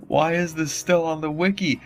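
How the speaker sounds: plain reading voice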